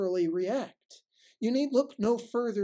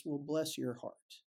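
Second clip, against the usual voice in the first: slow, softer, pitying bless your heart; knowing Southern put-down; 5 semitones lower, 6 dB quieter than usual. Retold or imitated. Southern lady to someone who said something stupid, pitying. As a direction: sweetly deliver a quiet dig. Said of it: "kindly Southern ladies ever says".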